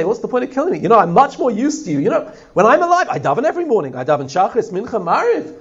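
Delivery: high, quick, pleading bargain with God; arguing with God. Retold or imitated. A person bargaining with God to stay alive, high and eager. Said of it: "we argue with God and say".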